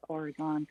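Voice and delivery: Oregon ending in a full GON; song's pronunciation. Shown as a demonstration. Explaining how the song says it, Oregon with GON ending. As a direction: say Oregon to end like "gone", for the rhyme. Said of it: "but in the song it's".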